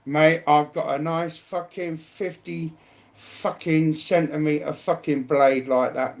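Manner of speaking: ordinary talk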